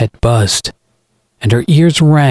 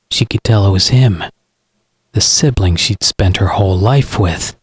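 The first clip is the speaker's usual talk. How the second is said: ordinary delivery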